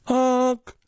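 loud, high held honk; imitated car horn honk. A narrator's reading. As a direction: blare it like an impatient car horn. Dad's car horn in driveway.

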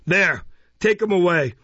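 loud, high impatient order; dismissive command. A narrator's reading. Beadle ordering the child out, impatient. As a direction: dismiss him with official disgust.

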